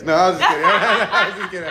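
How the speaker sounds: laughing. Real, not acted. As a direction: talk through a loud laugh. Host laughing at own joke.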